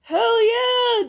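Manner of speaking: high, loud cheer; excited cheer. Acted. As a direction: shout it with delight. Denki cheering, hugging Shoto.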